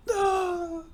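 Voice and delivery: high mock death scream; Wilhelm scream. Sniped bandit falling, high mock scream.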